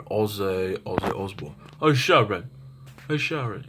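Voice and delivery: thick British mock call. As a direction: imitate a thick-accented rocker calling his wife.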